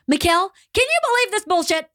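high-pitched, indignant venting; worked-up exclamation. Retold or imitated. Client venting to trainer in the gym, worked up. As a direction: sound off with passion, pitch jumping up. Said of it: "I'm not yelling".

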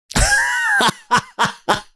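high burst of laughter; shrieking laugh. Real, not acted. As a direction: let out a squealing laugh. Laughing at current neighbours.

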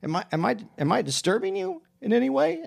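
retold pointed question, climbing high; mildly offended. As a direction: appeal to bystanders, a touch affronted. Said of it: "And then I got offended by it".

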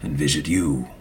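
low, measured character voice with long pauses; solemn Maka. Acted. Maka asking Lord Fievel a favor, low and solemn. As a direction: speak low and unhurried, with quiet courtesy.

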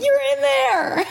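put-on high, sing-song baby talk; cooing to baby. Talking to her unborn baby, high cooing baby talk. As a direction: coo it like to the bump.

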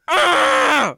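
loud pained argh; pained yell. Retold or imitated. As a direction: yell out in pain. Yell of pain in chair.

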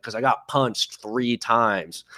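lightly voiced annoyed winner, dragging the words; imitating sulky fighter. Fighter explaining his anger to the hosts, mock sulky and slow. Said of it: "He's like".

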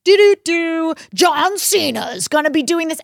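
loud, high mock hype-announcer voice; imitating an over-the-top ad; telephone ad announcer. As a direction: blare it like a fight promo jingle. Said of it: "it's, like, a telephone ad".

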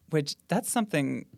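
ordinary talk, nothing marked

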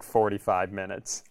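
normal talking voice

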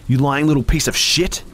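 seething through gritted teeth. A narrator's reading. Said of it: "she breathed through gritted teeth".

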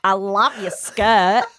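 slow, drawn-out off-topic compliment; dreamily ignore the question; a hint of a spacey colleague; colleague's reply.

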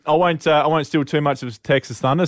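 ordinary talk

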